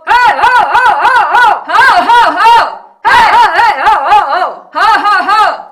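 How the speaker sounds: full-power repeated hailing calls; long-distance calling. Shown as a demonstration. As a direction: holler to a cow far away. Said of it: "the cow is one hundred meter away".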